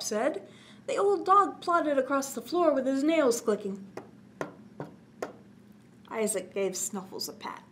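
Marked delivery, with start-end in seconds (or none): none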